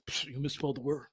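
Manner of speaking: low, soft mocking nitpick; muttered mock complaint; whiny commenter. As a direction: sneer it like a petty critic.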